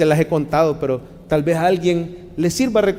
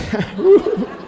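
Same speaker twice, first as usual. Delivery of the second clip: laughing; 4 semitones higher than usual. Real, not acted. Risa sobre días de atleta.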